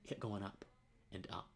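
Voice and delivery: real emotion, dropping to a hush; overcome, voice sinking almost to a whisper; hushed and choked up, holding it together; emotional. Real, not acted. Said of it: "I'm getting a little emotional right now".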